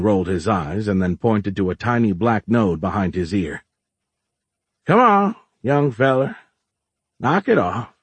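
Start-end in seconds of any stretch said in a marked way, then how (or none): 4.9-7.9 put-on old-timer telling off a youngster; folksy shopkeeper, scolding. Plays Orlin scolding.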